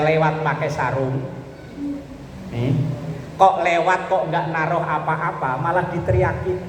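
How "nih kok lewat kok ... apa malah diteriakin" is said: usual speaking voice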